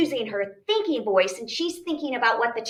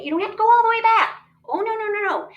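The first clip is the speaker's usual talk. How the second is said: excited reassurance; 13 semitones higher, 6 dB louder, much faster than usual. Shown as a demonstration. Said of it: "You only have to".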